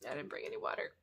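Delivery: scratchy dry throat; rough, dry-throated talking; hoarse voice. Real, not acted. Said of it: "I'm sorry if I sound like a robot".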